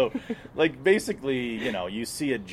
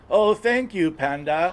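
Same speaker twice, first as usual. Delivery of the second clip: 1 mock grateful tripper; 9 semitones higher, 5 dB louder, much slower than usual. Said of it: "Panda, what am I supposed to be?".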